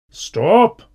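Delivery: loud, high, sudden shout; cutting command. A narrator's reading. Teacher halting the pupils' argument, cutting shout. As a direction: cut across the squabble forcefully. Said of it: "Mister Brown's voice cut the air".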